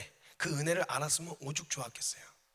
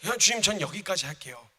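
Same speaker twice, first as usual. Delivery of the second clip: telling God he quits; 4 semitones higher, 8 dB louder than usual. Retold or imitated. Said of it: "이렇게 그만하겠다고 먼저 얘기할 수도".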